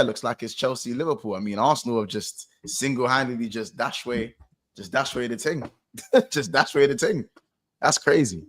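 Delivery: ordinary delivery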